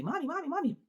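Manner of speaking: high, whiny repeated "mommy"; needy kids. Retold or imitated. Mother imitating her needy kids calling her, high and whiny. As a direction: imitate clingy children pulling at you.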